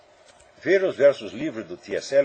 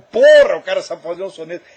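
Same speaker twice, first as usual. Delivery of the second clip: loud, high-pitched swearing exclamation that eases off; amazed outburst; 4 semitones higher, 13 dB louder than usual. Retold or imitated. Teacher recalling his reaction to a well-made sonnet, amazed. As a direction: burst out in impressed disbelief.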